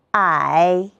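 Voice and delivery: "I" as ǎi, dipping low then rising; third-tone "I", long low dip; tone drill. Shown as a demonstration. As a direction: sink "I" low and lift it, third tone. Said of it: "和老师一起比划着读一读吧".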